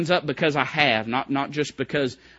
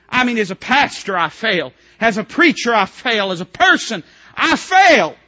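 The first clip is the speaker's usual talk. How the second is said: higher, louder, building preacher's shout; full-force preaching; 8 semitones higher, 10 dB louder than usual. Acted. Preacher voicing a believer calling to family, building shout. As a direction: build it up, calling out each relative.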